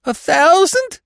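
high, loud cry of disbelief; amazed. A narrator's reading. Prisoner hears the reward sum, amazed cry. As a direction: shriek it as an astonished question.

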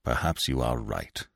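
low, soft growl. A narrator's reading. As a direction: grumble it down low and quiet. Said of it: "he growled".

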